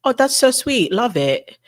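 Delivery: put-on calm British accent. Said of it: "so much calmer when she's British".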